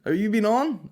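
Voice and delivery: high, loud, excited Belfast bloke voice; mocking the bar guy. Comedian mimics a rude bar guy recognising him, high and loud. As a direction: ham up a nosy punter spotting a comic. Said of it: "this guy's like".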